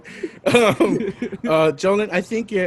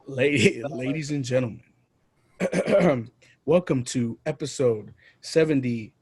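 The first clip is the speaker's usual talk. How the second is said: host announcement; 4 semitones lower, 3 dB quieter than usual. Acted. Opening the show as host.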